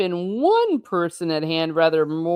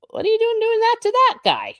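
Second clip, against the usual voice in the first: very high, incredulous protest; high pitched mock alarm at the cold water; shocked outburst; 3 dB louder, faster than usual. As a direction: cry out at the housekeeper in disbelief. Reader reacting as if in scene.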